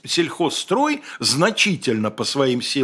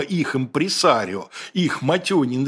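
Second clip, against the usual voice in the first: ordinary delivery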